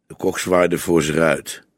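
usual speaking voice

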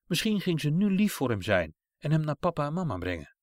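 plain reading voice

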